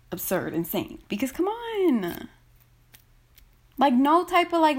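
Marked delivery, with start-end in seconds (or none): none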